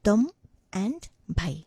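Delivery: "tom" and "bhai" said apart with a clear gap; split into syllables. Shown as a demonstration. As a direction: say "tom", pause, then "bhai". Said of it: "break it down into syllables".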